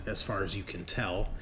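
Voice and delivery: usual speaking voice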